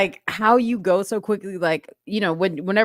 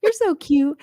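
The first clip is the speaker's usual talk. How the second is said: lightly mock-sweet; cooing over the kids at wake-up; gushing mom; 9 semitones higher than usual. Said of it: "she's like".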